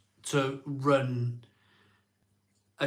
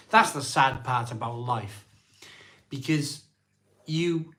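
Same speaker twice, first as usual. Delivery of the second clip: normal talking voice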